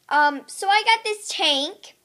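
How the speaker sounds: ordinary talk, nothing marked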